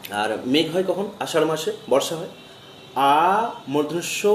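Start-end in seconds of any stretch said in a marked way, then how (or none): 2.9-4.3 drawn-out, slow letter naming; slow spelling. Shown as a demonstration. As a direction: spell it out slowly. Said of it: "উচ্চারণ করা". (ধীরে টেনে বানান)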